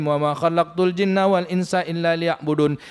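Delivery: ordinary delivery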